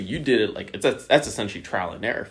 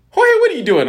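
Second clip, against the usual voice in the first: high, loud startled grandma calling his name; grandma's call; 10 dB louder than usual. Retold or imitated. Grandma catching him, calling out high and loud.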